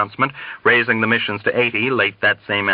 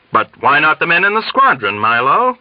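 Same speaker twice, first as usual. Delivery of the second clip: eager, raised exclamation; exclaiming; 6 semitones higher, 4 dB louder than usual. A narrator's reading. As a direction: blurt it out as a bright idea. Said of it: "Colonel Cathcart exclaimed".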